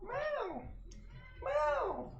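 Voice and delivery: very high, very soft coo to a pet; high hushed pet voice, meows included. Real, not acted. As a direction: sweet-talk the cat in a tiny high voice. Meowing at the cat.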